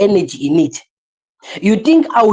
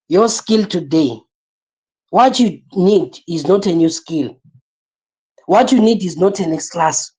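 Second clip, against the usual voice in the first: normal talking voice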